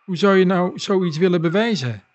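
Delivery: ordinary delivery, nothing marked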